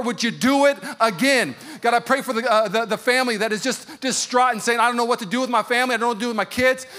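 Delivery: loud, high impassioned sermon cry; fervent shouting prayer over the church; preaching at full force. Acted. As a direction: pour it out like a revival preacher calling to God. Preaching climax, calls out prayer.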